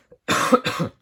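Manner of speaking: harsh dry cough; coughing fit. Real, not acted. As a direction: cough hard into the mic. Coughs mid sentence.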